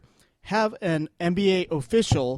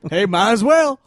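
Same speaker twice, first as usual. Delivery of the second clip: louder, higher carefree voice; mock carefree shrug; 6 semitones higher, 7 dB louder than usual. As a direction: shrug it off cheerfully. Mock carefree criminal, loud far.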